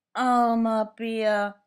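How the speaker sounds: high, slow doll's sigh. Acted. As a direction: sigh it out as the little doll. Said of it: "seufzt Annabella".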